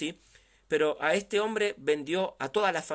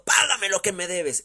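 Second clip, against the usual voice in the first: loud, raised demanding shout; harsh demand; 3 semitones higher, 6 dB louder than usual. Acted. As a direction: bark the demand for payment. Servant demanding debt violently.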